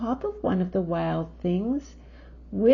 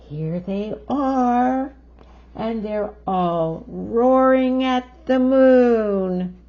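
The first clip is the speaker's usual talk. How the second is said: slow, louder thrilled telling; excited showing; 5 semitones higher, 8 dB louder, slower than usual. Real, not acted. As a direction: marvel at the page. Excited pointing out to child.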